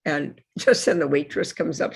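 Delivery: ordinary talk, nothing marked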